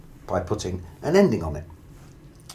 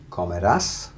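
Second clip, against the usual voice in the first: comerás with emphasis on rás; comerás, rising onto a strong rás; final-syllable stress; 3 semitones lower than usual. Shown as a demonstration. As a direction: punch the last syllable of comerás. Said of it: "stressing that very last syllable".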